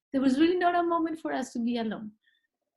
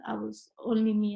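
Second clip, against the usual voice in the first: ordinary delivery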